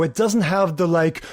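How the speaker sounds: ordinary talk, nothing marked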